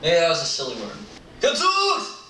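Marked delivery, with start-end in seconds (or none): 1.4-2.2 Gadzooks! in a high squeal; high exclamation. Pretend. Exclaiming the silly word.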